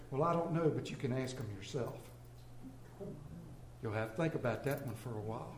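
put-on a little girl answers the teacher, softly and sly; girl's sly answer. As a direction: deliver the punchline quietly, deadpan. Girl's quiet comeback punchline.

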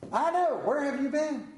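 lightly voiced grandpa welcoming; grandfather's greeting. Grandfather greeting the grandson at the door, fondly. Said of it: "grandfather's favorite statement".

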